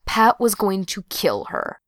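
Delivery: ordinary delivery, nothing marked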